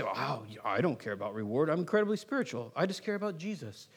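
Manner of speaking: low, soft sanctimonious voice; mock pious. Believer claiming not to care about reward, mock pious. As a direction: put on quiet, super-spiritual humility. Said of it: "you might say".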